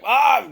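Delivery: high, loud mock cry of urgency; short frantic holler; panicked yell. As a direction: yelp as if racing for the toilet. Frantic dash for bathroom, retold.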